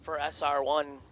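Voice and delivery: brisk play-by-play call over the PA; race announcer. Acted. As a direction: call the drag race live, punchy. Live drag race calling.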